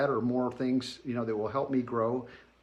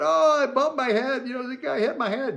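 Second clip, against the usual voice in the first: put-on high, louder childlike voice, shrugging it off; much higher kid voice, casual about the injury; little boy voice; 11 semitones higher, 5 dB louder than usual. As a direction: pipe up like a kid downplaying a bump. Retold child answering dad.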